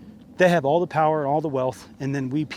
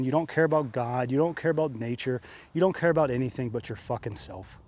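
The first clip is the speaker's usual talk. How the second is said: ordinary talk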